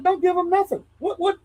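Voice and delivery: high, indignant refusal; an old-school guy refusing to hand over his details; retold outburst. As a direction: fire it back, high and pushed. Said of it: "I said".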